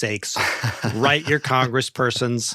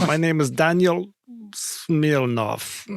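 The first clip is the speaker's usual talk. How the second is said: mock Russian accent; 5 semitones higher, slower than usual. Cohost introduces himself as a Russian heir in mock Russian accent. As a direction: announce yourself in a cartoon Russian accent. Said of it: "a Russian accent with it?".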